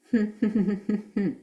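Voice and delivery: light giggle held under the breath; giggle demo. Shown as a demonstration. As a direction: show a small contained giggle for the rhythm.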